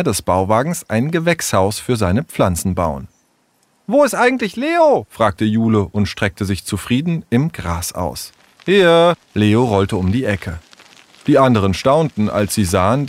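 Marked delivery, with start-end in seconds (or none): 3.9-5.1 put-on much higher girl's voice asking; lightly voiced young girl; curious Jule. Jule asks, voiced figure. 8.6-9.1 louder, higher call; a boy calling back. Acted. Leo calls out arriving.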